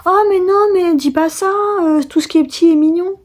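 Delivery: high, cooing mock reassurance; hammed-up high-voiced consoling; gushing reassurer. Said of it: "la personne elle va dire".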